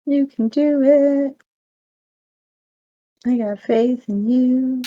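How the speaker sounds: high, drawn-out mock encouragement; high cheerleading. Player cheering the drones on in a grapple, high and slow. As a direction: egg them on slowly in a high voice.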